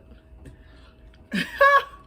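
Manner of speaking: big high laugh; loud burst of laughter. Real, not acted. Laughs after own line.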